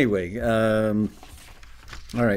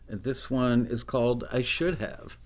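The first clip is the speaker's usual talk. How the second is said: normal talking voice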